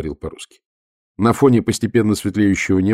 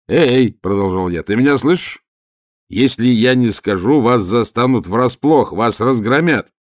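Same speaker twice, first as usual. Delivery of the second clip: shout; 2 semitones higher, 5 dB louder than usual. Acted. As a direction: shout it up, spelling out the threat. Shouting up to silent guard.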